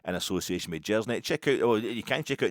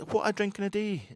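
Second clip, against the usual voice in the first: mock Welsh; 12 semitones higher than usual. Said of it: "He says".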